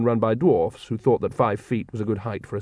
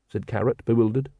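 plain reading voice

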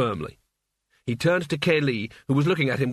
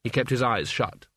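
ordinary delivery, nothing marked

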